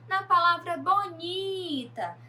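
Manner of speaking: bonita said slowly, "ni" lifted and leaned on; teacher marking the penultimate syllable; stress on bonita. Shown as a demonstration. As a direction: raise and slow down on bonita. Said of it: "penúltima sílaba a mais forte".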